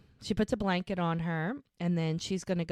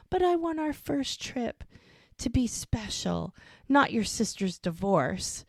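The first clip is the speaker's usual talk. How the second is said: higher-pitched mock pleading, slowing at the end; wheedling; 6 semitones higher than usual. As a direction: coax her, imitating him. Retold man wheedling his partner.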